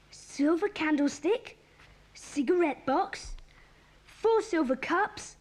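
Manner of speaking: slow, deliberate list reading. Acted. Reading a thieves' list to the gang, slow and deliberate. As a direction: spell out each item slowly.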